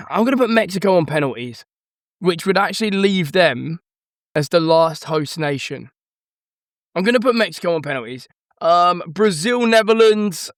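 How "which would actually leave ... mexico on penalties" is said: ordinary talk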